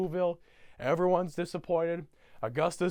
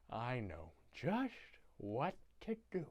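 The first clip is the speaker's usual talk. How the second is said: soft, slow conspiratorial voice; scheming Grinch; 3 semitones lower, 12 dB quieter, slower than usual. Retold or imitated. Grinch hatching his plan, slow soft scheming. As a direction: draw it out quietly, lifting at the end. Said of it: "And the Grinch goes".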